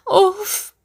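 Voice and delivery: high exasperated "oof"; forceful huff. Acted. Rania huffs at her boyfriend, exasperated. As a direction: blow out a heavy sigh. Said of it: "صاتت بالجهد".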